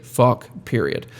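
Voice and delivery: normal talking voice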